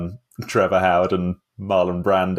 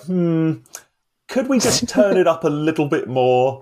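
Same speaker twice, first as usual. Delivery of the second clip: higher, lightly voiced mock suggestion; musing film-maker; 7 semitones higher than usual. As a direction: imitate someone musing over a tweak. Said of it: "someone else was like".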